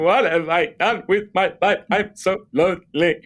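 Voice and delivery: high, wailing self-pity; mock lonely lament. Drunk lonely lament on balcony.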